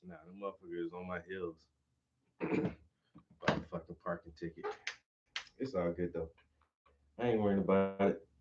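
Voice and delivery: a low-volume quick aside; quiet muttering. Real, not acted. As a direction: grumble it under the breath, fast. Muttering quietly over background.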